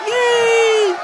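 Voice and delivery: loud high cheer; big happy whoop. Real, not acted. Cheering the podcast's return.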